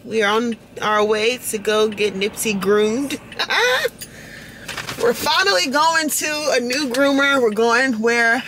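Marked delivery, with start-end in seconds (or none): 3.2-4.0 high laugh. Real, not acted. Laughing about dog.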